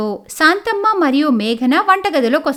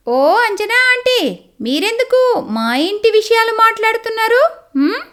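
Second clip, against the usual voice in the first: put-on higher, pointed young woman's voice; sharp young lawyer; 8 semitones higher than usual. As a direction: put the nosy aunt in her place. Neha confronts the neighbour.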